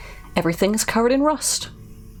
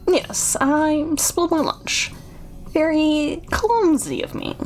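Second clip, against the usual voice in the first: lightly voiced eccentric host, starting high; a hint of sinister politeness, with odd pauses; creepy innkeeper; 4 semitones higher, slower than usual. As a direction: excuse yourself like an awkward, creepy host. Voices Chuck explaining the spill.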